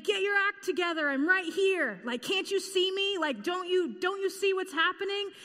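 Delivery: high, fast, exasperated scolding; mock angry Jesus. Preacher imitating how people imagine Jesus, mock angry. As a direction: play an impatient Jesus demanding to be seen. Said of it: "angry and intense".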